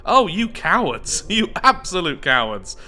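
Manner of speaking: mock outrage. Player scolding the hidden elite, mock outrage. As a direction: jeer at them in playful outrage.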